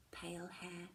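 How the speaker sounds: usual speaking voice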